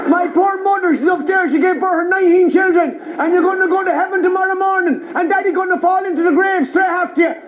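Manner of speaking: indignant mock Traveller rant; imitated Traveller accent. Comedian goes all in on Travellers, ranting in their accent. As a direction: pile up grievances in a Traveller accent. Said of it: "answered them in their accent".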